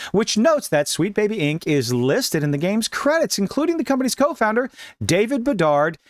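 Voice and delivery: normal talking voice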